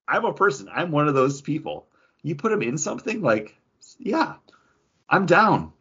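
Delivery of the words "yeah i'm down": usual speaking voice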